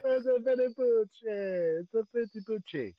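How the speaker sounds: slow hammed-up Italian accent; mock Italian accent. Echoing the co-host's mock Italian, slow and hammed-up. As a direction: stretch it like a cartoon Italian. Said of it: "talk with an Italian accent".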